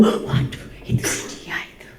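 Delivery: hushed excited whisper about a crush. Retold or imitated. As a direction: whisper it as if the crush just walked by.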